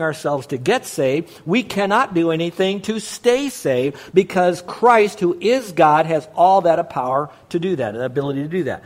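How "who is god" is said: normal talking voice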